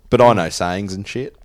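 ordinary talk, nothing marked

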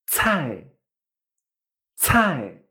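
cai as "tsʰai" with a strong puff of air; cai, breathy ts and falling tone; aspirated fourth-tone cai. Shown as a demonstration. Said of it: "sale bastante más aire".

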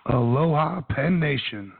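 raspy, hoarse voice; losing his voice, rough and raspy. Real, not acted. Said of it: "sounds absolutely terrible".

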